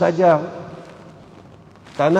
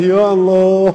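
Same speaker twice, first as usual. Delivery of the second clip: imitating a tearful plea, growing louder and slower; hammed-up crying prayer that builds in volume; mock weeping prayer; 2 semitones higher, 5 dB louder, slower than usual. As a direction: wail ya Allah like someone sobbing at the Raudah. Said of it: "kita nangis".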